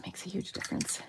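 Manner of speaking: soft unvoiced whisper; a hushed little aside. Real, not acted. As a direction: whisper it to yourself. Whispered aside while switching water.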